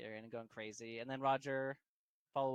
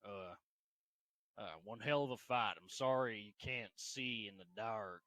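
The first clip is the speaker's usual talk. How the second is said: Roger character voice; 3 semitones lower than usual. Acted. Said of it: "And Roger's like".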